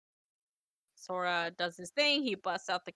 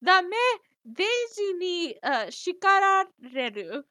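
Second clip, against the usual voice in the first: high stammering duck protest, slowing down; mock-flustered cartoon outburst in Japanese; Donald; 10 semitones higher, 7 dB louder than usual. Retrying Donald's Japanese line for the co-host, mock panic. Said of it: "so he's like".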